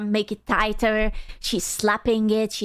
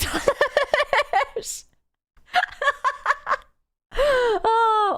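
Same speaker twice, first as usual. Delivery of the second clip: laughing; 11 semitones higher, much slower than usual. Real, not acted.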